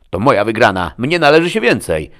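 loud, high-pitched outburst; indignant protest. A narrator's reading. Quarrel over sharing the money. (głośny, oburzony protest)

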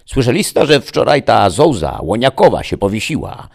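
loud, high gossiping village wife; blurted gossip. A narrator's reading. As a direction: fire the news out like a mortar shot. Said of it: "wypaliła nagle jak z moździerza". (głośno wypalona plotka wiejskiej baby)

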